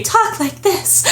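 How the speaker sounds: high, slow, put-on breathy voice; mock breathy voice. Joking that the tough guy would talk breathily, mock breathy voice. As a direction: parody a starlet voice, high and drawn out. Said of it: "this big tough guy".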